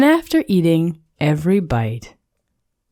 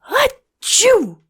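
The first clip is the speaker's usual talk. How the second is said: very high, big acted sneeze; a huge cartoon achoo; gigantic sneeze. A narrator's reading. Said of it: "The snowman sneezed a gigantic".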